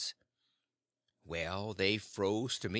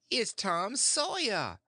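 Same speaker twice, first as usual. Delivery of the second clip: high, slow, louder announcement; triumphant reveal; 9 semitones higher, 4 dB louder, slower than usual. Acted. Aunt revealing the boy to her husband, triumphant and slow. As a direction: present him like a prize.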